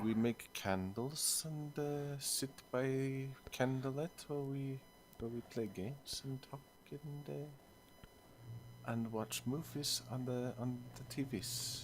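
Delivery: put-on slow, sing-song Norwegian accent; hammed-up Norwegian accent, drawn out slow. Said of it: "I just went for the classic, uh, Norwegian".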